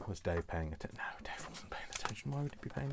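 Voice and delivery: hushed whisper, aside to herself; a quick whispered joke about Dave. Real, not acted. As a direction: whisper it so Dave won't notice. Aside about Dave not listening.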